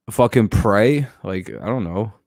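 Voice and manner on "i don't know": ordinary delivery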